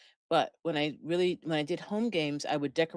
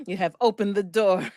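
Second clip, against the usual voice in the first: dark lord; 4 semitones higher, 8 dB louder than usual. Pretend. Mock villain after evil cackle.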